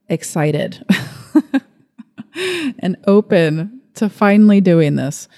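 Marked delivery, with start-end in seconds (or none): 2.0-2.8 nervous little laugh. Real, not acted. Nervous laugh about starting.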